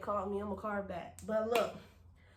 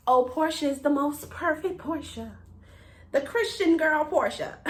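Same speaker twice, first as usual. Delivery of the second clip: higher-pitched mock admiring voice; put-on, higher voice poking fun at others' praise; teasing mimicry; 5 semitones higher than usual. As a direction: mimic gushing admirers, ending in a laugh. Mimicking what everybody thinks of her.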